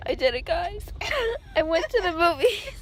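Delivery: loud giddy laughter while talking; breathless embarrassed laughing; laughing excitedly. Real, not acted. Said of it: "She's freaking out".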